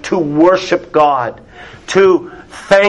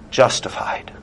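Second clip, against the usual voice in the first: softer, whispered verdict; 6 semitones lower, 7 dB quieter than usual. Acted. Preacher whispers the hard verdict to the congregation. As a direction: let the word fall to a whisper. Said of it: "to put it bluntly".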